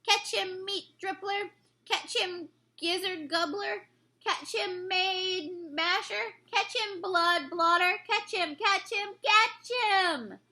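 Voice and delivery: high, rowdy chant of calls; a gang of brutes yelling across a field; giants shouting. A narrator's reading. Giants hurling the BFG, shouting to each other. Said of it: "fearful danger that one of the giants".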